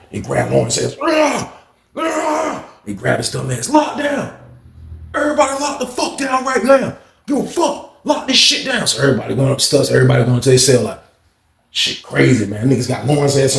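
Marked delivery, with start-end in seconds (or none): 2.0-2.6 mock yelp. Lawrence grabbed. 3.7-8.9 high, commanding call; officer ordering everyone to lock down, high; shouted order. Retold or imitated. Guard ordering lockdown.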